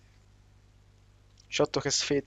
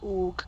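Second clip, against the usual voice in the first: pained grunt; 6 semitones higher than usual. Acted. Punched in the face.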